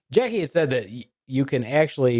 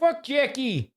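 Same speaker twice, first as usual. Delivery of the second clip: high-pitched playful cursing; hammed-up high jeer; mock outburst; 13 semitones higher, 3 dB quieter, much slower than usual. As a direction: curse him in high mock protest.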